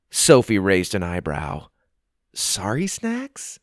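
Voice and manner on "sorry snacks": puzzled question. Acted. Sophie puzzled by snack name.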